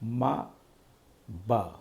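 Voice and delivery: ما hummed through the nose then با popped from closed lips; short quiet ما, با drills; lip sounds ma ba. Shown as a demonstration. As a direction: demonstrate ما and با as bare syllables. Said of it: "میم اور بے کی آواز آئے گی".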